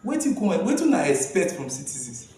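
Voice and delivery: usual speaking voice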